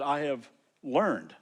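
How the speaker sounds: "learned" slowed and weighted at the end; stress on learned. Shown as a demonstration. Pastor pointing out the word he circled, slow stress. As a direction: dwell on "I have learned", slowly. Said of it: "I had to circle that word learned".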